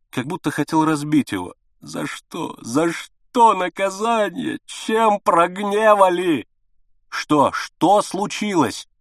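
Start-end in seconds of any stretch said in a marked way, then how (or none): 1.8-6.5 despairing lament. A narrator's reading. Father beating his head in despair. 7.1-8.9 anxious outcry. A narrator's reading. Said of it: "выкрикнул я".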